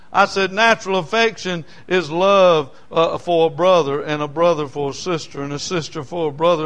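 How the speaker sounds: loud, rising preacher's cadence; preaching at full force. Acted. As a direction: drive the point home to the congregation. Building sermon on natural affection.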